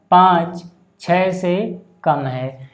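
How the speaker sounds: very slow, spaced-out reading; reading demonstration. Shown as a demonstration. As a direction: read it out word by word, very slowly. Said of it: "याद रहे इनको पढ़ने का तरीका".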